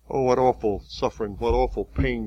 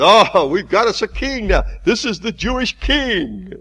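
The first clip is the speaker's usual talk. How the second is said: much higher and louder jeering cry; mocking soldiers; 10 semitones higher, 6 dB louder than usual. Soldiers jeering at their prisoner, high and loud. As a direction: howl the mock title up high.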